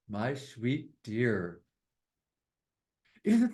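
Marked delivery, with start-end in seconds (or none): 0.1-1.6 closing poem line. Acted. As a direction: let the poem come to rest.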